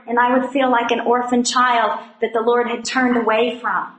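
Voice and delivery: usual speaking voice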